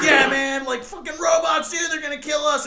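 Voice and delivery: high, loud hyped-up agreeing; mock shout. Pawn shop worker egging on the ranting customer, high and loud. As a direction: fake outrage to egg him on.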